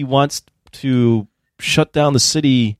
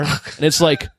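ordinary delivery

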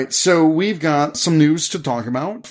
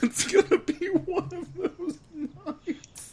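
laughing; 10 dB quieter than usual. Real, not acted. Laughing resigned remark.

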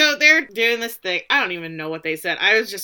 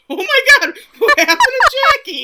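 high, loud quote through laughter; laughing mock shock; 9 dB louder than usual. Podcaster quoting Ty's shocked discovery, laughing in mock horror. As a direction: cry out in mock horror while cracking up.

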